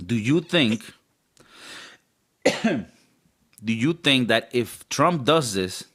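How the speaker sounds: normal talking voice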